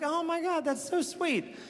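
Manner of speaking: mock-touched gush; gushing sweetly. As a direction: melt over the nickname like it's adorable. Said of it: "And I was like".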